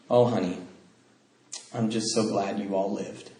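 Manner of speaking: soft, calm and tender; gently relieved mother. Retold or imitated. Mother calmly answering her son's amends, soft. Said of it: "in just a calm voice".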